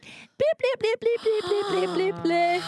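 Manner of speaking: high, bouncy blips like a hopping flea; playful high blips mimicking a jumping flea; blip sound effect. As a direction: pop out each blip up high.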